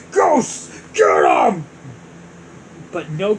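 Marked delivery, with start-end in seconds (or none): 0.1-1.7 loud commanding shout; shouted order. Acted. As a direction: yell the order to minions.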